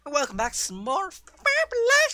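high, loud showman intro, slowed right down; shrill-free but soaring announcer voice; hammed-up show host. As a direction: belt it like a camp TV host. Over-the-top show intro.

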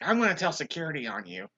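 high, fast imitated threat; mock indignant outburst; angry threat. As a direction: play an irate woman threatening to tattle.

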